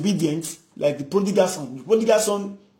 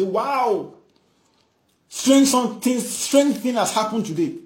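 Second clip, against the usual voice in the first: amazed; 6 semitones higher, 5 dB louder than usual. Acted. Crowd amazed at healing.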